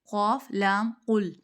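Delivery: قل with a deep heavy ق, slowly; heavy qaf. Shown as a demonstration. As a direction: spell out قاف لام قل, then a thick ق in قل. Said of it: "کینچی والا موٹا قاف ہے". (قل موٹے قاف کے ساتھ آہستہ)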